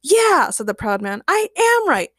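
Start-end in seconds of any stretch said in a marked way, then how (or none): 0.0-0.5 high, loud, indignant retort; proud man. A narrator's reading. 1.3-2.1 high, emphatic insistence; proud man. A narrator's reading.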